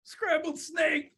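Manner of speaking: high, squealed alarm; panicked snake. Acted. Snake hears its own fate, high acted panic. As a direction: cry the words in cartoon horror.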